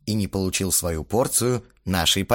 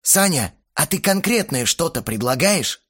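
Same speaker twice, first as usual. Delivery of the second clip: slightly raised, careful question; cautious; 6 semitones higher than usual. A narrator's reading. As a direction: ask with mild caution. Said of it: "осторожно сказал молчавший". (осторожно)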